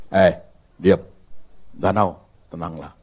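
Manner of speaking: low, soft calming command. Acted. Jesus quietly commanding the lake, calm. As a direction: settle the storm gently.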